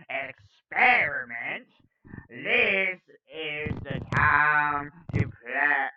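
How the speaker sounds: slow, loud, drawn-out villainous proclamation; hammed-up evil emperor decree; Palpatine voice. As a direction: declaim it like Palpatine, stretching the key words. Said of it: "why I gave him that voice".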